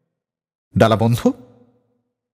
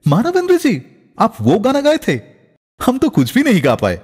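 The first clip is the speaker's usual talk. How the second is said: startled, name cried out much higher; retelling a singer's surprised greeting, pitched up; 7 semitones higher than usual. Retold or imitated. Singer meeting a fellow singer in the green room, startled exclamation. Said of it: "একেবারে চমকে উঠে বললেন".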